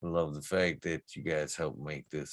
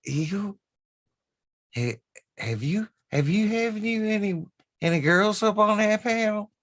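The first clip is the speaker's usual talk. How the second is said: Cass Cass Southern voice; 8 dB louder than usual. Retold or imitated. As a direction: imitate Cass with a thick Southern accent, pitched way up. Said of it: "my best Cass Cass voice".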